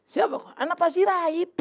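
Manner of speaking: lightly voiced doorman at the side door. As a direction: play the cinema doorman letting him in.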